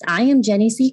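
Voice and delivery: usual speaking voice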